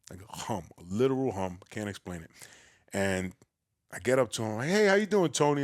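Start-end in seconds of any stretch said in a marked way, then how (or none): 4.7-5.6 raised, louder New York greeting; higher-pitched, brash New York hello; big New York voice. Retold or imitated. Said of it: "in my big New York voice".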